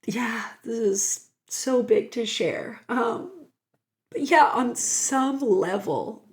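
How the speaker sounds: choked, breathy voice near tears; real crying while sharing; tearful. Real, not acted. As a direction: speak through real tears. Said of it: "we always apologize for crying".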